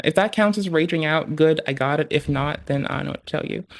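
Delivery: normal talking voice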